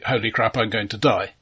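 ordinary talk

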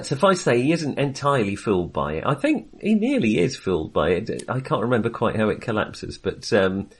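ordinary delivery

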